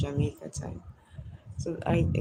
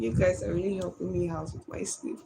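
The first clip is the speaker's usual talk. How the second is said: normal talking voice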